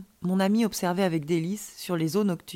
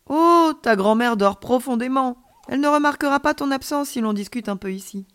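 put-on persuasive child; 5 semitones higher, 6 dB louder than usual. A narrator's reading. Alien child persuades friend to stay and talk, coaxing. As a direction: coax in a high, lively voice.

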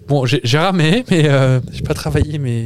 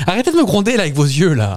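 high, mock-offended plea to the director; mock protest; 6 semitones higher than usual. Woman teasing the director behind camera, mock offended. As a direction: play hurt at being scolded, high.

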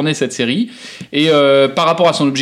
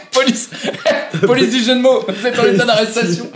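mock police officer; 8 semitones higher than usual. Mock police arrest joke.

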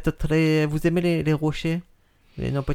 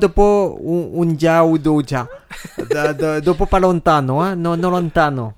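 fake Italian accent; 5 dB louder than usual. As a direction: play a French guy faking Italian. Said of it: "tu parles très bien le faux italien".

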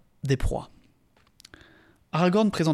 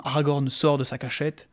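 ordinary delivery

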